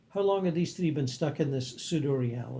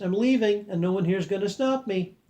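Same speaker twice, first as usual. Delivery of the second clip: higher, louder bold announcement; announced; 6 semitones higher, 5 dB louder than usual. Acted. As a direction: declare it to the room, a touch loud.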